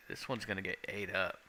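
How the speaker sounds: ordinary delivery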